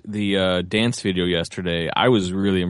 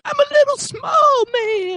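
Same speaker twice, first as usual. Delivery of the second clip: tiny squeaky little man; pitched far up and shouted; highest voice; 3 dB louder than usual. Pretend. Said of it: "highest voice you can make".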